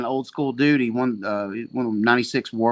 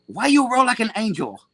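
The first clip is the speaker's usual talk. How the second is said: much higher, louder broken-English jab; Seneca impression; 9 semitones higher, 6 dB louder than usual. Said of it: "I got a good Seneca impression".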